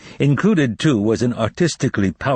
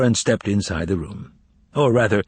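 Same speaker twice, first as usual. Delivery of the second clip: ordinary talk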